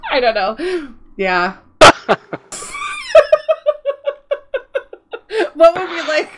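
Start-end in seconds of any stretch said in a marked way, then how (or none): 1.8-6.3 high laughing fit. Real, not acted. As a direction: crack up laughing.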